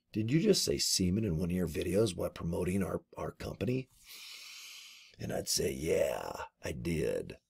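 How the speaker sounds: a hint of a stern caller; quiet mock call. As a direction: murmur the imagined complaint call. Imagined Avon call.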